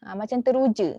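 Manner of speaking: ordinary talk, nothing marked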